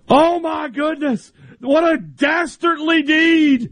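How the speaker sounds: high, slow melodramatic shouting announcer; booming old-time announcer. Retold or imitated. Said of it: "the announcer ... is like".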